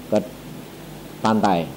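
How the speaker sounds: ordinary talk, nothing marked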